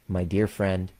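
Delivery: usual speaking voice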